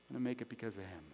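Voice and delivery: low, hushed declaration; quiet conviction. Acted. Habakkuk crediting God for his survival, quiet and low. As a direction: drop right down, soft and low. Drops to quiet after the shout.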